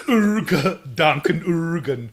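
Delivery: put-on slow, high German-accented correction; cartoon German accent; mock German. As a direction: overdo the German vowels.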